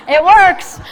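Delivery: said through a loud laugh. Real, not acted. Presenter laughs telling the class it works, laughing. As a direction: laugh it out, delighted.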